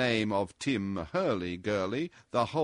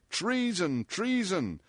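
shrieked; 7 semitones higher, slower than usual. A narrator's reading. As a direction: cry out twice in contained, high outrage. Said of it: "shrieked Her Majesty of the Mouth".

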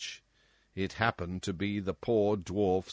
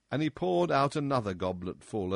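plain reading voice

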